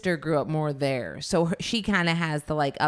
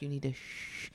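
hushing; 11 dB quieter than usual. Retold or imitated. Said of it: "I'd be like".

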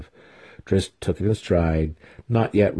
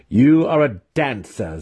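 higher, scornful jab; derisive retort; 5 semitones higher, 4 dB louder than usual. A narrator's reading. Mentor belittling his student's claim, derisively. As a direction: shoot it back with derision. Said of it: "in a derisive tone".

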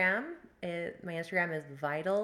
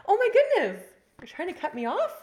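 high, loud, laughing protest; mock outrage; 14 semitones higher, 14 dB louder than usual. Real, not acted. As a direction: squeal it out in laughing disbelief.

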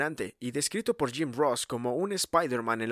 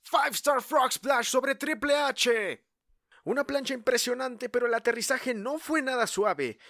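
excited; 10 semitones higher, slower than usual. A narrator's reading. Recapping a huge frog splash.

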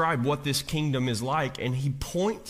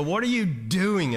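higher and louder, fast, exasperated at God; 5 semitones higher, 4 dB louder than usual. Acted. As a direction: throw up your hands and ask God what's going on. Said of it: "argument with God and go".

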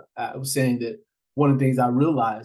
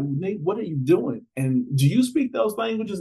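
baffled question; 7 semitones higher than usual. Retold or imitated. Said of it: "folks are like".

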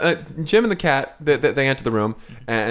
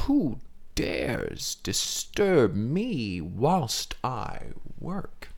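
slow, menacing boss growl; dragon voice; 3 semitones lower, 7 dB quieter, much slower than usual. Acted. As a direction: drag it out like a disturbed dragon. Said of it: "and she says".

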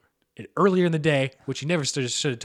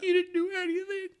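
very high mock-tearful girlfriend; high squeaky imitation through tears; crying girlfriend. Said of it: "so she started crying".

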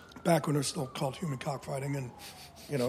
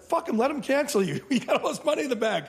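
mock pep talk; 9 semitones higher, 4 dB louder than usual. Advice quoted to rich friend.

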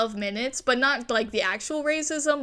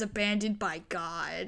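ominous whisper; 4 semitones lower, 9 dB quieter than usual. Retold or imitated. Sign text read ominously.

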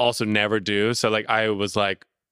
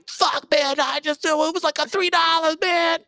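panicked tax dodger. Retold or imitated. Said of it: "You're like".

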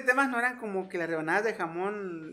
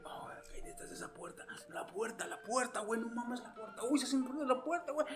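hushed suspense; 2 semitones higher, 11 dB quieter than usual. Retold or imitated. Retelling hyped suspense scene.

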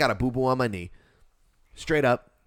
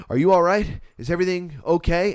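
loud, raised-pitch check on someone; urgent concern; 6 semitones higher, 6 dB louder than usual. Retold or imitated. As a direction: act out rushing up to help. Acts out rushing to help.

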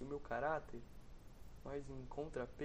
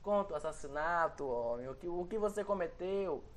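bright, loud coaxing call; cheerful urging; 3 semitones higher, 3 dB louder than usual. Acted. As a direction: cheer him on to talk. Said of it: "um apelo alegre".

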